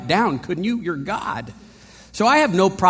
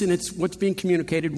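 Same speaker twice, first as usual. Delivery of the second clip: ordinary talk, nothing marked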